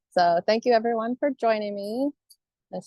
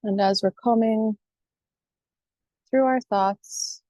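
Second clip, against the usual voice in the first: guided meditation. Acted. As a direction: guide listeners calmly, phrase by phrase, leaving space.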